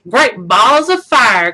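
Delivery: slow drawn-out Southern goose exclaim. A narrator's reading. The goose declares the new nest hers, drawn out. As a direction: belt it like a folksy goose. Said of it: "cried the goose".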